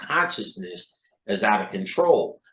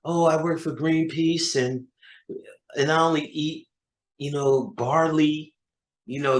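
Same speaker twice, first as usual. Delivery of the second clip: lightly voiced mock hippie, pitched up; mocking do-gooder; 3 semitones higher than usual. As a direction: parody a preachy do-gooder. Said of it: "You wanna be good person?".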